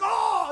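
high loud yelped oh, imitated; mock shout of pain. Pastor imitating brother's yell at another sting, mock shout. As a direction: yelp as a second sting hits. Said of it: "And he's like".

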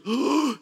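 sharp astonished gasp, acted; imitated gasp. Woman testing her healed ankle, acted amazed gasp. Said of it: "she gasps".

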